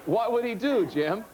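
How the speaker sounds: normal talking voice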